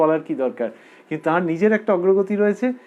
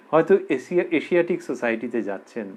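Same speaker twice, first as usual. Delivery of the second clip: ordinary talk, nothing marked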